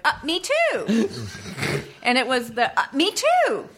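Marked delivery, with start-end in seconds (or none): 0.1-1.1 dreamy, slow ditzy "me too"; lightly voiced airhead girl. Shown as a demonstration. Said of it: "Sample dialogue?". 2.8-3.7 high ditzy "me too"; lightly voiced airhead girl, pitched up. Shown as a demonstration. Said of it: "that got me that role".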